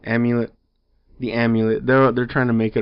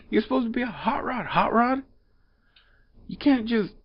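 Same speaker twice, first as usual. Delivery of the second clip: exasperated; 10 semitones higher than usual. Real, not acted. Exasperated rant at the character.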